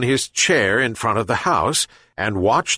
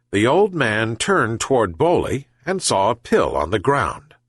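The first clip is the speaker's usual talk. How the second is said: plain reading voice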